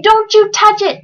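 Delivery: high-pitched urgent shout; shouted warning. A narrator's reading. Bartholomew warning the trumpeter off the oobleck, shouted. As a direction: shout a warning to stop him. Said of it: "shouted Bartholomew".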